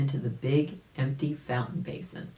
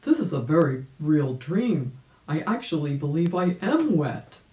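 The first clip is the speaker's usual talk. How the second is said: Uncle Wiggily; 4 dB louder than usual. A narrator's reading. Uncle Wiggily waking wet.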